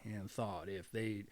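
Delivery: usual speaking voice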